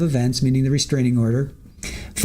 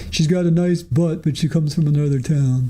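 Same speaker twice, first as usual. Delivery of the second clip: lightly put-on quoting voice; Higgins. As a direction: slip into a mild imitation of someone gossiping. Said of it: "I think that's what Higgins said".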